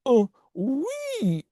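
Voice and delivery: high, hammed-up French "oui"; terrible French accent. Potato salad answering the storyteller, high bad French accent. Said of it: "you have a terrible French accent".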